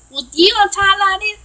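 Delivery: put-on Texan talk; mock Texan accent. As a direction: imitate a nosy stranger faking a Texan accent. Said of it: "you don't sound like a normal Texas".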